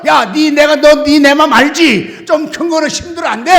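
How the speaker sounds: loud, coaxing talk to the pine; loud address to a tree. Retold or imitated. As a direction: shout fondly at the tree. (소나무에게 크게 말 걸듯)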